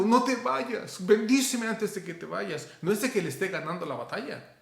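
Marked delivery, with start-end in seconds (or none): 0.0-2.7 tearful plea. Retold or imitated. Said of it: "dice el profeta Oseas que está llorando".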